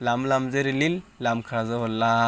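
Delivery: normal talking voice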